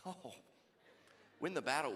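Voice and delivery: ordinary talk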